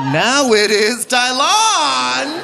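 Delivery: high, slow "Now it is Dylon!"; gleefully embracing the new name; high, drawn-out ecstatic; mock enthusiasm. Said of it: "I was like".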